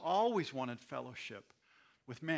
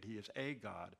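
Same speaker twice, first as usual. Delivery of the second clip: normal talking voice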